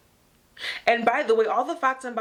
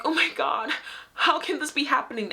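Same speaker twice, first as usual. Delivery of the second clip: mock distress; 6 semitones higher than usual. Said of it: "they're like".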